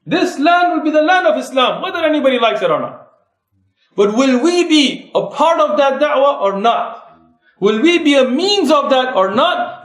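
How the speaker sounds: rising, driving sermon voice; impassioned preaching. Acted. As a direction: rouse the congregation to action. Preaching call to da'wah.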